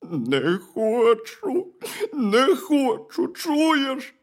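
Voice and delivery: high, slow, tearful wail; crying bear cub. A narrator's reading. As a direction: bawl it slowly like a sulking cub. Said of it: "Ведмедик гірко заплакав".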